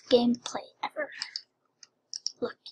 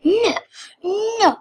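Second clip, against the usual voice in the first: dismayed pleading; 7 semitones higher, 3 dB louder than usual. Real, not acted. Game turns against the player.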